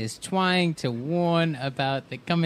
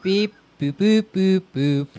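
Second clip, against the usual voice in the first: mock robot; 5 semitones higher, 4 dB louder than usual.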